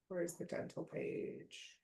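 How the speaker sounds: whispered aside to herself; under the breath, slow and soft; muttering to herself. Real, not acted. Muttering to herself, looking for a page.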